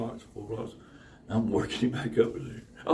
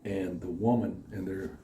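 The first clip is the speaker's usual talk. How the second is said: ordinary talk, nothing marked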